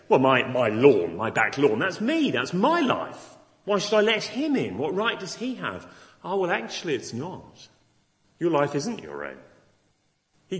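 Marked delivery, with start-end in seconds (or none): 0.1-5.9 louder, higher, a defensive listener protesting; indignant objector. Pretend. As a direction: play the offended sceptic guarding his lawn. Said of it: "Oh, you might say".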